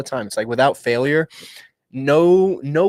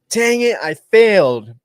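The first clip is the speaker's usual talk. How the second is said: loud, drawn-out retold cry of frustration; frustrated outburst; 9 semitones higher, 8 dB louder, slower than usual. Movie character owning up to failing, loud frustrated outburst. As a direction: exclaim it higher and louder, stretched out.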